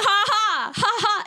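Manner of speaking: high, loud fake laughing; mock laugh. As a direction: imitate the guy's cackling. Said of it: "And then".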